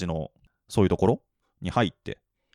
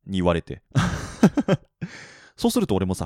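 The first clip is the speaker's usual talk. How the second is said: usual speaking voice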